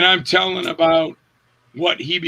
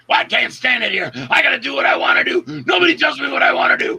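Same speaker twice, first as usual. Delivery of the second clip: pitched high, fast, shouted, unhinged; acting out of control; 7 semitones higher, faster than usual. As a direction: explode like a man losing control. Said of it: "I need you out of control".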